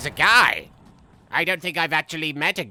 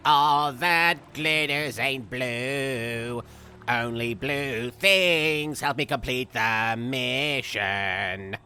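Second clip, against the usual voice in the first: slow parody line; 3 semitones lower, much slower than usual. Pretend. Slowed lyric parody.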